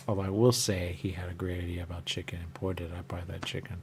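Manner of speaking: usual speaking voice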